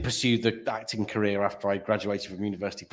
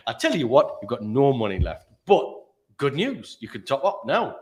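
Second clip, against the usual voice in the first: mock Geordie call-centre patter; Geordie accent; 5 dB louder than usual. Call centre telling a customer about their balance, put-on Geordie. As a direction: break the news in a Geordie lilt. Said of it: "they chose Geordie as the accent".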